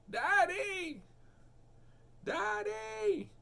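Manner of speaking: put-on high child's call from a distance; imitating a small son calling Daddy. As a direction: call up to dad like a little boy. Son calling from far below.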